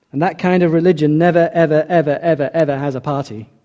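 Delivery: "never, ever, ever" hammered one after another, fading down; building never ever. Real, not acted. Preacher insisting to the church, repeated emphatic never. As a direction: pile up each "ever" with weight. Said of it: "repeats never ever for emphasis".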